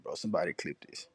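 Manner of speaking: ordinary talk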